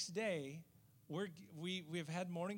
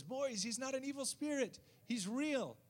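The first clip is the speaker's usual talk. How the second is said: put-on high, raised voice to the boys; calling out; 8 semitones higher, 5 dB louder than usual. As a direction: imitate an old man hollering news to hidden children. Old man calls hidden boys out.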